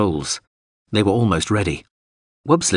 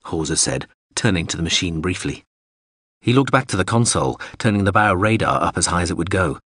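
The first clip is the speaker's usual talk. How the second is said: plain reading voice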